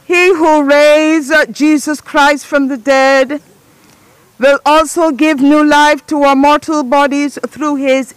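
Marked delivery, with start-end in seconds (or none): none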